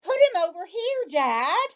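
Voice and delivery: put-on high, loud, fast boy's shout; high excited child's shout; Toby shouting. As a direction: yell it like an excited kid. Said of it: "Toby shouted".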